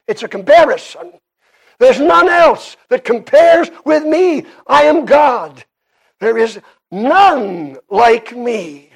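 ringing pulpit declaration; emphatic preaching. Acted. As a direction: preach it hard, leaning on key words. Preaches at full force.

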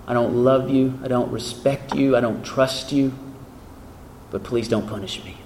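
lightly voiced demoniac, low and subdued. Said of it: "This is the demoniac".

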